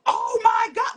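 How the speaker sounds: high, loud alarmed cry; panicked mom. Retold or imitated. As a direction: imitate a mother bursting in alarmed. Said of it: "She ran in the room, panicked".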